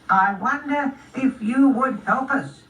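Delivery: high, slow snail speech; unhurried little snail, pitched high. Acted. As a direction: explain it slowly as a tiny snail. Snail asks Spot for help.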